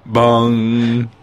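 long sung bong like a news chime. Pretend. Bonging the headline chime for the news feature. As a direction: ring out the headline gong.

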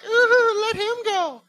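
high, loud mock girls' taunt; sing-song playground tease; teasing girls. Girls in line egging on the fight, mock teasing. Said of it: "the girls are like".

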